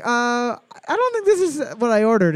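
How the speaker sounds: high, fast whiny customer voice; mock-offended restaurant customer; picky customer. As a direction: play a fussy diner sending food back. Customer complaining to server.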